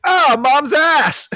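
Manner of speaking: high, loud, drawn-out mock exclamation; mock realisation. Retelling Dexter's reaction to the clue, mock realisation. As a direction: imitate a cartoon kid's sudden discovery. Retells character's sudden remembering.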